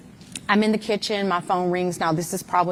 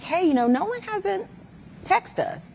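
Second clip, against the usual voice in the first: much higher, a quick mimic of her sister; imitating her sister; 13 semitones higher than usual. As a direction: lift the voice high to play the worried sister. Sister's quote, voiced higher.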